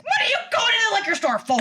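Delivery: mom's shocked voice. Retold or imitated. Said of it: "She's like".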